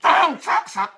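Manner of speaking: a furious list of orders, rising to a yell; escalating furious shout. Real, not acted. Furious rant calling for destruction.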